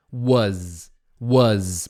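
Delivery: "Was" said "waz" with a and z; "was" with an open a and a final z; repeat-after-me drill. Shown as a demonstration. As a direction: lead the class in "waz". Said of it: "Pode até treinar agora comigo".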